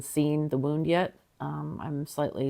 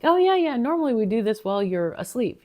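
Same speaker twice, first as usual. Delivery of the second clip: nurse voice; 8 semitones higher, 5 dB louder than usual. Retold or imitated. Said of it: "She's like".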